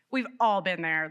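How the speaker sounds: normal talking voice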